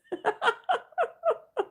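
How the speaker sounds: squealing giggle; high-pitched laugh. Real, not acted.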